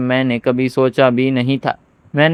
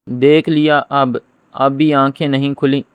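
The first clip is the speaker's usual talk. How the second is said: ordinary delivery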